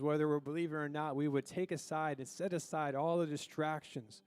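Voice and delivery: soft, low prayer voice; spoken prayer. Real, not acted. Leads the congregation in prayer.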